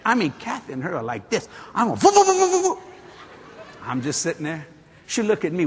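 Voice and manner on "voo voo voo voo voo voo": mock chatter. Imitates the women's argument.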